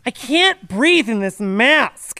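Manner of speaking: slow, high whiny complaint; whiny mask complainer. Pretend. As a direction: whine like a spoiled complainer. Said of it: "They're like".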